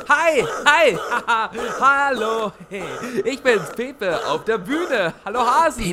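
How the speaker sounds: high, loud, laughing hello; giggly greeting. Acted. Pepe giggling hello to the rabbit crowd.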